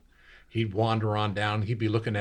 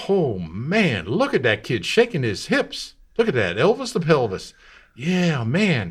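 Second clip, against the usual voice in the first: higher, louder thrilled viewer; excited shopkeeper; 5 semitones higher, 5 dB louder than usual. Retold or imitated. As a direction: cheer at the TV. Shopkeeper watching TV voiced.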